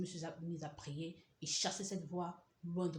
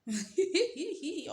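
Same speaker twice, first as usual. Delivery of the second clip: high laugh; 11 semitones higher, 7 dB louder than usual. Real, not acted. Laughing at her shame.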